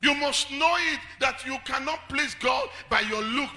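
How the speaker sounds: high, loud and slow; preaching shout. Acted. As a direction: shout it down to the church.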